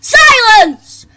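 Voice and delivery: very loud, high barked order; commanding shout. Acted. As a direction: silence the class at once. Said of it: "ordered Miss Battleaxe".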